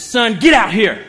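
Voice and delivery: loud shouted summons, laughing; calling out. Retold or imitated. Dad calling his teenage son onto the court, loud shout. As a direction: bark for the son to come.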